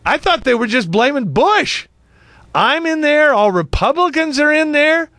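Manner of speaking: mock offence at being lumped in; sarcastic indignation. Real, not acted. Host objecting that all Republicans get blamed, high sarcastic protest.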